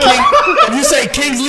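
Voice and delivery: laughing. Real, not acted. Laughing dread.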